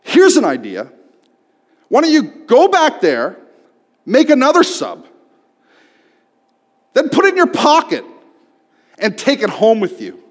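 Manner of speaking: loud, high, exasperated sarcasm; angry yell. Retold or imitated. Fed-up customer yelling sarcastic advice at the clerk.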